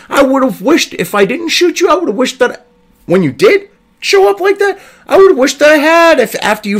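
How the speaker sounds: boil over defending her; worked up. Real, not acted. Telling the reporter she should have been shot, raging. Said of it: "I apologize for getting worked up".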